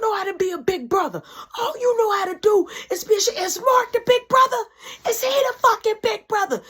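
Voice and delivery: high, loud, tearful scolding; furious mom yelling her son down; screaming mother. Retold or imitated. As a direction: scream it at him, near tears.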